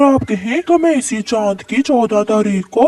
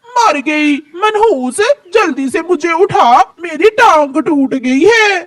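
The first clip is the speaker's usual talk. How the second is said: pained cry; 6 semitones higher than usual. Acted. Mother slips and falls hard.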